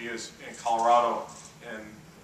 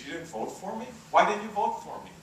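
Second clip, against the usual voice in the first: stunned disbelief; 8 semitones higher than usual. Retold or imitated. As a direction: pitch climbing much higher in shocked disbelief. Tip learns nuns didn't vote.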